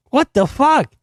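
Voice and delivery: high, amazed outburst; can't believe what he just heard; high astonished exclaim; stunned disbelief. Real, not acted. Stunned reaction to lyric.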